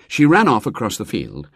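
ordinary delivery

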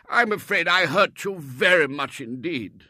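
put-on high, loud, pleading old Colonel; an old soldier begging pardon; remorseful Colonel. Colonel begging Robert's forgiveness, high and loud.

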